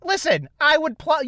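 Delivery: normal talking voice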